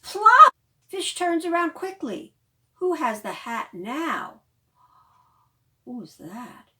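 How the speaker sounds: loud, lively storytime reading; reading to children. Acted. Librarian reading The Rainbow Hat aloud to children, lively. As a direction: bring the picture book alive for little ones. Reads picture book to children.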